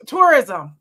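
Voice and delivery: loud, put-on tourist voice. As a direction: say it like a visiting tourist. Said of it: "that was my tourist voice".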